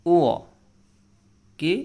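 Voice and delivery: ordinary delivery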